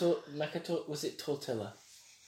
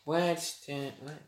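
normal talking voice

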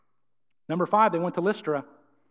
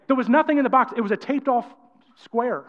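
ordinary talk, nothing marked

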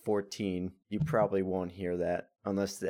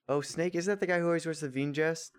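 ordinary delivery

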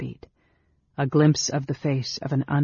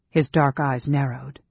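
ordinary delivery, nothing marked

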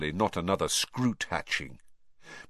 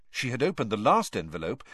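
usual speaking voice

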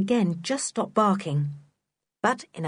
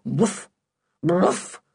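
woofs growing louder; dog barking; 4 dB louder, much slower than usual. A narrator's reading. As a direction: bark like an excited dog. Dog bark voiced by narrator.

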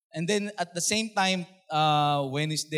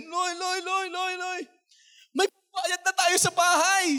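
excited shouting sister; 12 semitones higher, slower than usual. Retold or imitated. Sister phoning at dawn, shouting joyful news. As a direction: shout the good news high. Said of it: "Sabi niya ganun".

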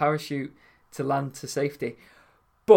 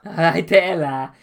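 a slightly louder Liverpool greeting; Scouse accent; 5 dB louder than usual. Shown as a demonstration. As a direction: show him how a real Scouser says it.

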